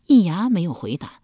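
ordinary delivery

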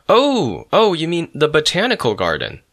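high, loud "oh" of realization; suddenly enlightened. A narrator's reading. Janitor finally understanding the student, high-pitched dawning realization. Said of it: "he responded after a moment, enlightened".

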